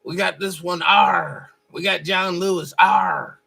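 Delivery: loud growling pirate "R". Retold or imitated. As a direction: bark it like a cartoon pirate. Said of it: "like a pirate".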